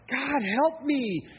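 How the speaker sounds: high, loud, drawn-out cry for help; mock-desperate plea. Preacher as parent of one challenging child, mock-desperate plea. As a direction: plead to God in comic exasperation.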